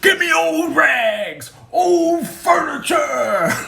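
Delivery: long, high street-vendor cry; rag man's shout. Acted. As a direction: holler it out slowly, drawing out the words. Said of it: "the old rag man would drive down shouting".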